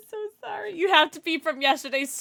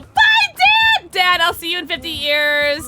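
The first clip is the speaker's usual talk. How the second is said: very high, loud shouted "Bye, Dad!"; high loud cheery shout; yelled goodbye; 5 semitones higher, 7 dB louder than usual. Retold or imitated. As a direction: call out a bright farewell. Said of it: "I'm gonna go".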